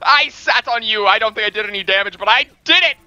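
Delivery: high, loud gloating shout; excited. Real, not acted. Player after sitting on an enemy, excited shouting. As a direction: crow it excitedly over your victim. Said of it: "I'm so fucking excited about this".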